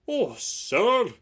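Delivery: high, loud happy cry; delighted gasp of thanks. Acted. Old engine thanking the owner, high and happy. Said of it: "said Skarloey happily".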